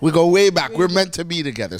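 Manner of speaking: loud, high punchline shout; excited shout. Real, not acted. Comedian shouting his love for Saint Martin, loud and high. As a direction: belt it out to land the joke.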